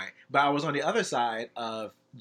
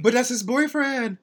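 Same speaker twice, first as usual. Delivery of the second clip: shocked; 11 semitones higher, 7 dB louder than usual. Real, not acted. Shocked at explicit video.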